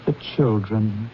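soft, low, gentle chiding; husband hushing his wife softly for the children's sake; gentle reproach. Acted.

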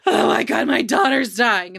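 imitating a panicked shout; mock yell of a frantic mom; retold panic, yelled out; frantic mother. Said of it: "Sharon was, like, all over the fucking place".

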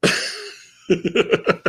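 usual speaking voice

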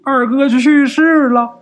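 breaking into a high, loud, slow wail on the last words; tearful voice. Acted. Uncle tells brother of a death, tearful. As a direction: fight back tears, then let them out. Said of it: "还带着哭腔就说".

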